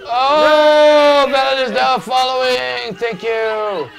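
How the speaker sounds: high, loud follower shout-out; shouted thanks. Real, not acted. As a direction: burst out with a big hollered announcement.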